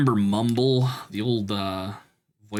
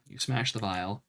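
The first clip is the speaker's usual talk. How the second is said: ordinary talk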